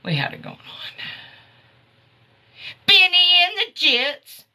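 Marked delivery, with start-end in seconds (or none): 2.8-4.5 high, loud exclamation of the title; excited exclaim. Real, not acted. Host celebrating the [name] song, loud and high. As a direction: sing out the song name with glee.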